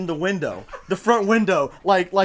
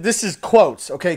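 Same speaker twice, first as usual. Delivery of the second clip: normal talking voice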